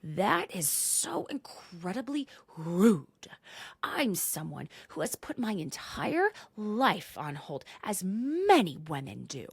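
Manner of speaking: slow, emphatic mock indignation; wounded self-importance, every word drawn out; affronted. Acting Heather's slow indignant speech.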